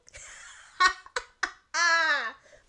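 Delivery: loud, high, drawn-out cackle; triumphant laugh. Acted. After making the whole sauna laugh, triumphant laugh. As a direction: let out a big gloating laugh. Relishing the room laughing at joke.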